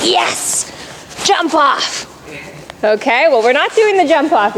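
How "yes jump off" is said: imitating an eager horse, half-whispered yes; mock-excited, punchy burst as the horse. Coach voices the horse keyed up for the jump-off, excited. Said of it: "he just gets very excited too".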